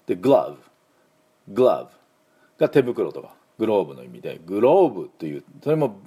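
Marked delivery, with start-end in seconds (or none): 0.2-1.9 グラブ with a short a sound; English glove. Shown as a demonstration. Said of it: "Vだから唇軽く当てて".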